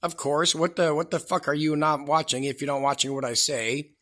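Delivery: ordinary talk, nothing marked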